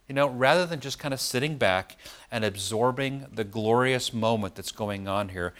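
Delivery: ordinary delivery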